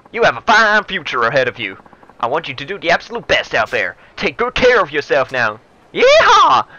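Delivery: loud, high, hammed-up Texan politician; big showy Texan, building to a whoop; Texan accent. Senior politician warns younger rival, over-the-top Texan accent. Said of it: "offended with that accent".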